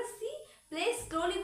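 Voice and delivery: slow, drawn-out demo; slowly. Shown as a demonstration. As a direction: stretch "slowly" out to show slowness. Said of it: "वो बहुत धीरे खेल रही है".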